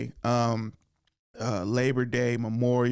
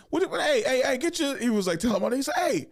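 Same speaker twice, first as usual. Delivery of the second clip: much higher, sputtering outrage; mock indignant uncle; 14 semitones higher than usual. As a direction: splutter like an offended uncle calling the dad.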